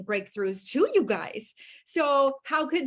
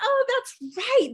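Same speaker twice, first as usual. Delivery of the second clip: a bigger, delighted second "Oh, that's right"; excited realization; 10 semitones higher, slower than usual. Retold or imitated. Host mimicking listeners' hearts, a high slow excited realization. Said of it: "your hearts are like".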